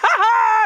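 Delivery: gleeful villain's cackle, high and loud; triumphant mad laugh; evil laugh. Acted. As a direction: cackle at the confused enemies. Villain gloating over confused enemies.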